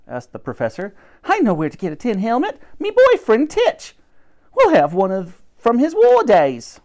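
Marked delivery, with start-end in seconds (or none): 1.2-6.8 much higher, louder cockney cleaning lady; squeaky eager charwoman. Acted. Dottie the cleaning woman.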